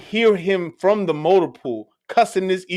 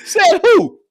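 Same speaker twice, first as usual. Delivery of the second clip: mock yell, much higher and louder; retold shout; 7 dB louder than usual. Sergeant challenging the detail order, mock high shout. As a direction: shoot it back like an angry sergeant. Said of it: "He goes".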